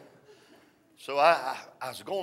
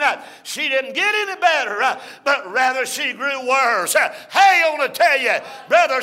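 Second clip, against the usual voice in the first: full-force preaching; 11 semitones higher, 11 dB louder than usual. Acted. Preacher drives the sermon home to the church, loud chant. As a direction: preach it hard to the congregation.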